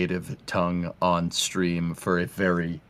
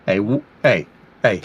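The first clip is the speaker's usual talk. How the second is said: mock Boston tough guy; 5 dB louder than usual. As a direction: act the offended Boston guy telling them off. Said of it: "minus the whole having a Boston accent".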